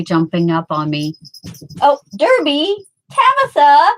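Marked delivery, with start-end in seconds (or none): 1.8-3.9 high, louder call to the cats. Real, not acted. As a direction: sing out the cats' names. Calling her cats by name.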